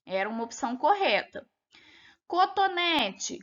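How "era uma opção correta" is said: ordinary delivery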